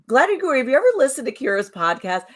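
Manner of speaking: high, fast and eager; bursting to ask the guest; excited. Real, not acted. Co-host eagerly asks Gladiator Guru, high and fast.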